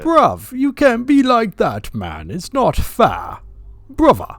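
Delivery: high, loud mock British plea; British accent. Co-host pleads with his bruv, high mock British accent. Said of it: "to start my British accent every time".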